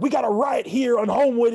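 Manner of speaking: loud, high shouted police call-in; hammed-up loud police radio shout; cop's radio call. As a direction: yell it like a cop calling in backup. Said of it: "the police officer's like".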